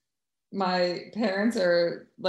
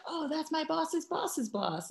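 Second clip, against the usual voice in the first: high, slow, hushed awe; awed employee; 10 semitones higher, 8 dB quieter than usual. Retold or imitated. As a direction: breathe it like spotting a celebrity. Employees awed by her dad.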